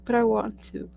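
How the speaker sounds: mock-pleading like a thwarted kid; pleading protest. Daughter answering mom who forbids haunted houses, pleading.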